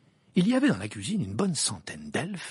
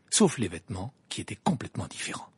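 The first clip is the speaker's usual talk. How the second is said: usual speaking voice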